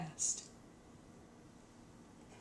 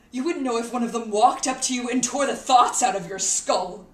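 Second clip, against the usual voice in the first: loud, raised-pitch ranting; angry tirade; 4 semitones higher, 10 dB louder than usual. Acted. Berating people who have it good, angry tirade. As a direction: berate them at the top of your voice.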